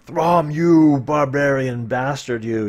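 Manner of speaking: loud, slow angry yell; yelling at Throm. Acted. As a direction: bellow it at the barbarian. Player yells at Throm in story.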